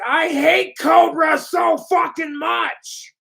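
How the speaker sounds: hammed-up furious troll rant; mock troll rage. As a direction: shriek it like a whiny angry troll. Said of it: "They're like".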